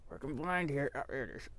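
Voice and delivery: flashlight in mouth. Real, not acted. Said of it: "Put the flashlight in my mouth".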